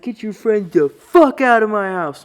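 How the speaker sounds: high, loud shrieking order; mom losing her mind. Retold or imitated. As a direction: scream it like a mother who has lost it. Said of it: "she's losing her mind".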